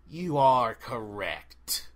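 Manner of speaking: very slow, otherworldly forest spirit; slow eerie tree spirit; dryad voice. Acted. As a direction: drag out each word like an ancient tree speaking. The Dryad answers from the trees.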